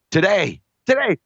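today, today, rising in disbelief; emphatic repeat. Real, not acted. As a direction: hammer the word today, going way up. Bragging about his forty time.

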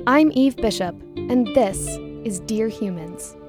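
ordinary delivery

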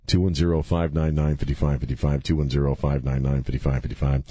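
droning repetition. Shown as a demonstration. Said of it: "repeat the phone number over and over".

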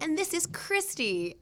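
high, slow, overly formal host voice; mimicking a man introducing his wife; mock polite introduction. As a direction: lift it up like a stiff introduction.

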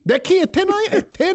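high, playful squeaky calling; mock high voice. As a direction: pitch it way up in play. Playful high nonsense cat-style calling.

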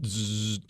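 soft buzzing window motor noise; electric window sound. Shown as a demonstration. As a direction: hum the window rolling down. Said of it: "that's my electric window sound".

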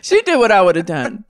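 laughing while owning up to it; real chuckle running through the words; amused, laughing. Real, not acted. Repeats own line amid laughter.

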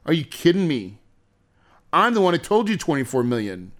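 ordinary delivery

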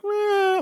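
very high thin imitated wail; creepy ghostly wail. Podcaster imitating the creepy female scream on the tape, high thin wail. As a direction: mimic the eerie girl's cry from the recording. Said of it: "very creepy sounding".

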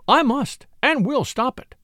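high-pitched, forceful determination; enraged insistence. A narrator's reading. Rachel vowing to stop the scandal, heated insistence. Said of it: "the rage that possessed her".

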